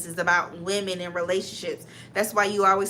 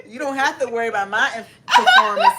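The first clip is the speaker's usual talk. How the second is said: laughing squealed brag; 3 semitones higher, 7 dB louder, faster than usual. Acted.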